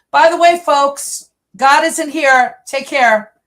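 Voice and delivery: high, loud parting shout; imitated yell back. Imitating husband yelling back from the church door, loud parting shout. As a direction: call it back over your shoulder, imitating a yell. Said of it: "he yells back".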